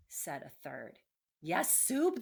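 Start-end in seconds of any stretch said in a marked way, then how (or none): 1.4-2.2 loud, high, gleeful agreement; eager chorus. Acted. Rats to each other, a loud gleeful agreement. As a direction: cry it out together, hungry. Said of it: "the others agreed".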